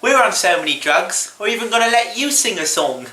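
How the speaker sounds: high-pitched mock Beatle voice; Beatle on drugs. Comedian's Beatle talking to [name], drugged impression. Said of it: "does an impression of him on drugs".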